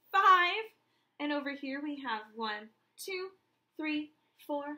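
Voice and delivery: usual speaking voice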